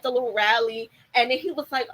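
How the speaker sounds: usual speaking voice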